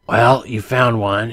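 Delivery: slow, emotionless reply in character; flatly. Acted. Lone survivor answers the searcher over intercom, flatly. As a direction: answer slowly, giving away no feeling.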